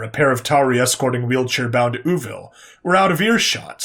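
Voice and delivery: usual speaking voice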